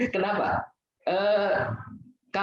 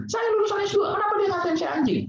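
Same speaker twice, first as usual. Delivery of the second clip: hammed-up outraged official; 11 semitones higher than usual. Speaker imitating a proud graduate outraged at an insult, high indignant complaint. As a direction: whine like a graduate insulted beyond belief. Offended person imitated, shouting.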